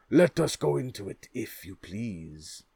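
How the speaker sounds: lively king voice. Acted. King's invitation.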